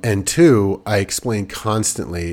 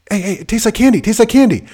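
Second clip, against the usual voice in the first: put-on high, louder sing-song kid selling it; coaxing kid voice; 12 semitones higher, 6 dB louder, faster than usual. Older kids coaxing the three-year-old to eat, high eager kid voice. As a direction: pitch up like a big kid talking a toddler into a bite.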